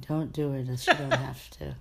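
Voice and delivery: usual speaking voice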